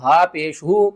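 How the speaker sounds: slow letter-by-letter recitation; slow spelling out. Shown as a demonstration. Teacher spelling the word for students, slow. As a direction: spell it out slowly. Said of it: "ہجے بھی کرتے ہیں".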